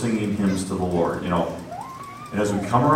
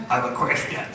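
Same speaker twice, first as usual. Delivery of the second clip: scared whisper; whispered fear; 4 dB quieter than usual. Retold or imitated. Frightened man whispering to the worker, scared. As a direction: barely get the words out in a whisper. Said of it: "my voice is cracking up".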